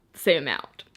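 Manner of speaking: ordinary delivery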